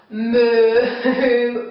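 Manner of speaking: imitated long cow moo. Shown as a demonstration. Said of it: "Can everybody do a cow noise".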